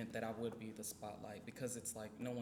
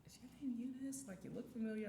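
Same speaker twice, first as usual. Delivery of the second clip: higher, curious schoolmate voice; imitating a schoolmate; 10 semitones higher, 5 dB quieter than usual. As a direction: lightly voice a puzzled old classmate. Imitating old schoolmates asking.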